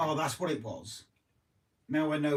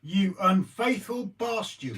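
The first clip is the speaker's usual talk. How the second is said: mock outrage; 8 semitones higher, 5 dB louder, slower than usual.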